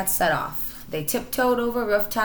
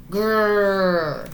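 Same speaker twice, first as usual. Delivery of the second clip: loud rumbling growl; tiger growl; 2 semitones higher, 5 dB louder than usual. Acted. Tiger growling at the little cats, loud and rumbling. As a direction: growl like a big cat.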